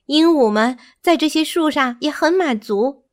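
lively, high bird character, persuading; advising bird. A narrator's reading. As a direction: pipe up as a small bird urging caution. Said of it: "塔基劝告".

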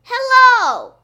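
high, loud hollered greeting; yelling. A narrator's reading. Boy testing the gym's echo, yelling. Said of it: "I yelled".

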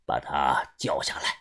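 低沉缓慢地吩咐; 压低声音慢慢下令; 沉稳的把头. Acted. 把头低声下令. (把头低声沉稳地发话)

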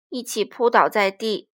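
plain reading voice

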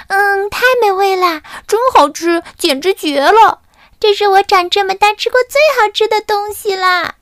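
high, squeaky raptures of delight; thrilled chick voice. Acted. Chicks rave about the sugar in high excited voices. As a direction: gush like a little chick tasting candy.

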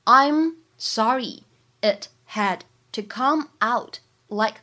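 very slow, word by word, every sound clear; slow reading. Shown as a demonstration. Teacher slows the line down for students, slow and clear. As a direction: spell it out slowly for the learners. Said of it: "first normal reading, then slowed".

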